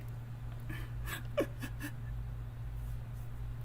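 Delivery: quiet, high, breathless giggling; long, helpless soft giggle; amused laugh. Real, not acted. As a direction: laugh softly at your own slip. Laughs at own name slip.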